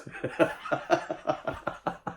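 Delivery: soft chuckling run of laughter. Real, not acted. Storyteller reaches the ten fingers punchline, soft rolling laugh. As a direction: chuckle at your own punchline.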